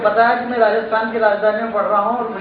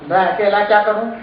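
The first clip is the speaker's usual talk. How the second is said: ordinary talk, nothing marked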